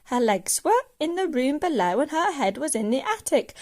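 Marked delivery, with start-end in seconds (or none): none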